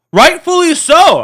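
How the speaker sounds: high, loud, vehement agreement; sudden fervent concession; emphatic aside. Real, not acted. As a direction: burst out in agreement.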